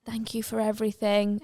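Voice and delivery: tender thanks. Acted. Gertrude waving back at John, soft and tender. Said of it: "a nice moment where she's like".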